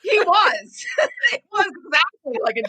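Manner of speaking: laughing through the words; real laughter breaking through, a bit quick; talking while laughing at the memory. Real, not acted.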